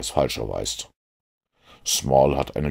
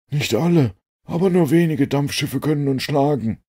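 much higher, louder character voice; Jones's voice; 12 semitones higher, 5 dB louder than usual. A narrator's reading. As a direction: keep Jones up high and loud. Jones replies to Holmes on river.

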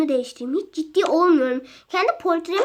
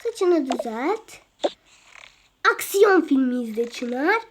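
normal talking voice

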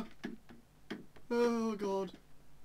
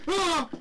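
scream; 7 semitones higher, 6 dB louder than usual. Real, not acted.

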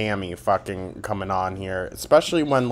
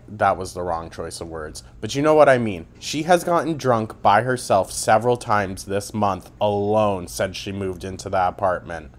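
ordinary talk, nothing marked

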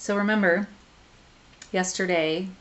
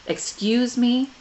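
huffy; 5 semitones higher, 6 dB louder, slower than usual. Acted. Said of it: "Bastille huffed".

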